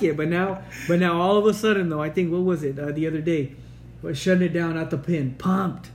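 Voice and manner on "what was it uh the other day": ordinary talk, nothing marked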